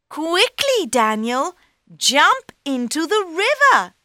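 high, loud and hurried; urgent cat. Acted. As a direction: urge him on, high and loud. Cat urges Daniel.

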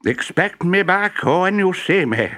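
high, slow cheerful professor calling. A narrator's reading. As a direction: call back cheerfully to the wife. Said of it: "he called".

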